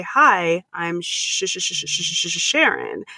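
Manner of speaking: real stutter, blocking on Sh- before Sharon; stuck repeating Sh- before getting Sharon out; stuttered name. Real, not acted. Introducing herself at a party, stuttering on her own name. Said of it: "I have to say".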